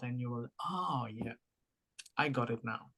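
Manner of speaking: usual speaking voice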